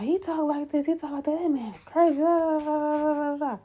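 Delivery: high-pitched griping to coworkers; mock venting. Nurse imagines complaining round the floor, high mock venting. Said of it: "talking about".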